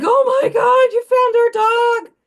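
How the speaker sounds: imitating kids who found their dog, high and loud; excited kids. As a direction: squeal it like thrilled little boys. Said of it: "They're like".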